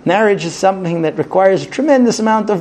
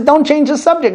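higher-pitched mock scolding; exasperated retort; 9 semitones higher than usual. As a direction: act out a wife cutting him off. Wife's retort in acted quarrel.

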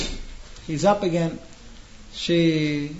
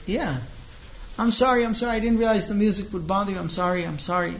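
apologetic; 5 semitones higher than usual. Retold or imitated. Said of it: "she's all apologetic".

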